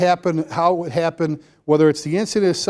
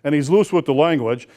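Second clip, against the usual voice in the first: a gruff, lower imitation of big Paul; imitating Paul; 3 semitones lower than usual. Chief mimics his big firefighter friend Paul, lightly gruff. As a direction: drop into Paul's rough voice for a moment. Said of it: "Paul talks like this all the time".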